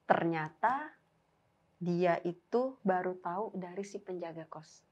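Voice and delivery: ordinary delivery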